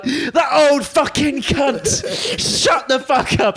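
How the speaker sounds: high, slow, outraged mock yell; imitating furious friend. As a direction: act out his fury at the old lady. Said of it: "first time I saw you angry".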